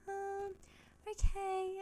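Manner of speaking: high, hesitant mock politeness; reluctant agreement. Reluctant reply to pushy visitor.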